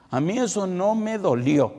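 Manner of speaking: insist, rising and pushing; son pleading harder. Retold or imitated.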